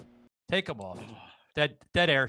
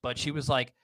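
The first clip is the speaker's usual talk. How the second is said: ordinary delivery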